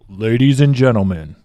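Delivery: boxing ring announcer intro; Buffer announcer impression. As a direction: call it out to a packed arena. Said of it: "Buffer is".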